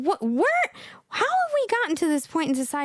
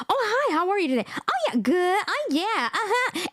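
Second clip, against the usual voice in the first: very high, sing-song small talk; mocked non-English speaker; 6 semitones higher than usual. Imitating women with little English making small talk, high mock chirp. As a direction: chirp empty pleasantries in a high voice. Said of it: "This is how they talk".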